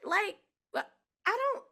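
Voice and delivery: 1 puzzled friend. Retold or imitated. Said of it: "she's like".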